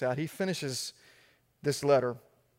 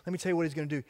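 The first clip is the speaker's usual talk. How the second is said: ordinary delivery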